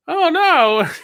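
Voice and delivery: very high, loud comic dismay; hammed-up high-pitched cry; Barney. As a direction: wail it like a cartoon drunk. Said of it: "Barney's like".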